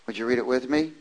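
usual speaking voice

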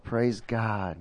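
usual speaking voice

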